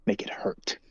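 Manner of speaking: breathy high whisper, cold and cruel; whispered menace. Acted. Character whispering up to the towering ally, menacing. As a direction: hiss the order softly up to him.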